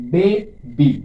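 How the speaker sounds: Baby in two separate beats, a clear gap between বে and বি; teacher chopping baby into বে, then বি; split into syllables. Shown as a demonstration. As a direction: break baby after বে, each half said firmly. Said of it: "দুইবারে উচ্চারিত হচ্ছে".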